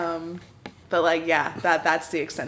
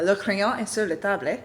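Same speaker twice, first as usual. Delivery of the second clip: light movie French. Retold or imitated. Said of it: "I Love Lucy taught me".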